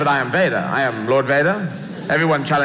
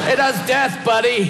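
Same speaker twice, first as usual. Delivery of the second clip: emphatic shout; 8 semitones higher than usual. Pretend. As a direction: yell "It does death" with does stressed high. Mock dialogue answer, emphatic.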